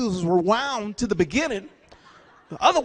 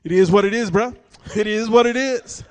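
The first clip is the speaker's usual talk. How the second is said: dismissive youngster; 3 semitones lower, 7 dB louder than usual. Retold or imitated. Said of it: "you're like".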